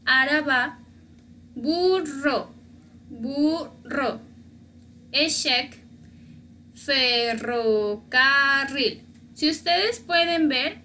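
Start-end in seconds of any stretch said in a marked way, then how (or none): 1.6-4.2 burro drawn out with a trilled rr; rolled double R. Shown as a demonstration. Teacher models double R mid-word, burro slowly trilled. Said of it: "doble R en medio de la palabra". 6.8-9.0 ferrocarril very slow, both rr trilled; double R twice. Shown as a demonstration. Teacher shows two double Rs, slow trilled ferrocarril. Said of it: "esta palabra tiene dos veces doble R".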